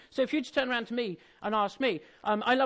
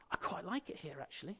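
soft, offhand throwaway voice; understated, casual quoted reply; nonchalant girl; 4 semitones lower, 13 dB quieter than usual. Acted. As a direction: say it softly, as if quite content and unbothered. Girl voiced, unbothered on sofa.

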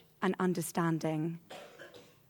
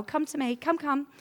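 higher, brisk teacher's beckon; a teacher beckoning a hurt child, a bit higher; teacher calling a child; 6 semitones higher, 3 dB louder than usual. Retold or imitated. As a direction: call the pupil over kindly but firmly. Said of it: "you know, I'm a teacher as well".

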